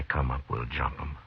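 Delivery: hushed warning whisper. Acted. Matt hushing Chester before the ambush, whispered. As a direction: keep it under your breath. Hiding from outlaws.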